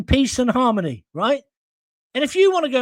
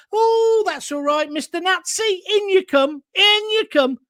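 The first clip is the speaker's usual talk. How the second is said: mock welcoming host; 9 semitones higher than usual. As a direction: gush them in like an eager doorman. Mocking welcoming Britain voice.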